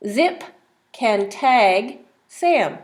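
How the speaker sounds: very slow, each word apart; slow reading. Shown as a demonstration. Teacher reading the tag page slowly for the child. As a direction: read word by word. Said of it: "Let's read the words".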